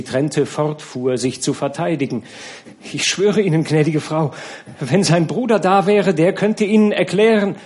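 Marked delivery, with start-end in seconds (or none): 2.9-7.6 high, loud pleading; trembling plea. A narrator's reading. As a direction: plead with a shaking voice. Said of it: "dass Nana zitterte".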